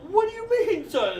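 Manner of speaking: shrill angry mother; going off at her son; angry rant. Pretend. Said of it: "she's gonna immediately go off on".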